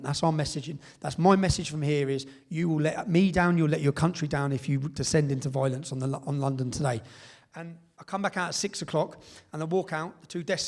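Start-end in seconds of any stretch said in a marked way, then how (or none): none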